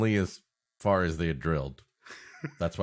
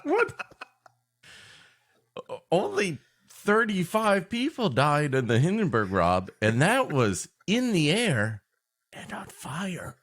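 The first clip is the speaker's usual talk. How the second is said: mock outrage; 10 semitones higher than usual. Mock outrage comparing disasters.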